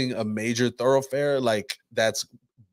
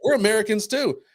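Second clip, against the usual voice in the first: mock protest; 10 semitones higher than usual. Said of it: "these women are like".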